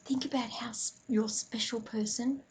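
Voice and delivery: calm breathing guide, softly hushed; guided meditation. Acted. Leads a mindful breathing moment.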